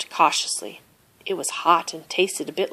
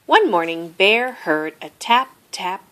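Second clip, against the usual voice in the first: ordinary delivery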